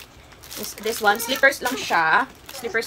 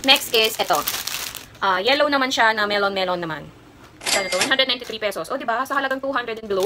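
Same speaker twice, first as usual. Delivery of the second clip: ordinary delivery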